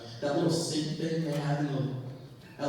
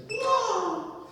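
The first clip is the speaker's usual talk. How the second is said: high, drawn-out whoa; mock startled whoa. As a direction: cry out as if dodging a punch. Acts out dodging an uppercut.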